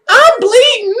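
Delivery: high, loud laughing shriek; retold wail. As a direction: shriek it while cracking up. Acting out her own cry.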